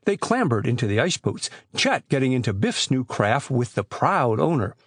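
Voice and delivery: ordinary delivery, nothing marked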